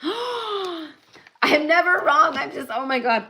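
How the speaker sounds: gasp, then a high, slow thrilled I'm never wrong; floored that the card proved her right; delighted gasp. Real, not acted. Card confirms her prediction.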